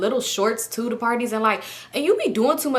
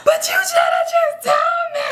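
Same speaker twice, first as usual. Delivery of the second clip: high-pitched wailing sob, mimicking yourself; hammed-up bawling through the words; crying outburst. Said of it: "I start crying".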